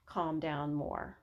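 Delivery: ordinary talk, nothing marked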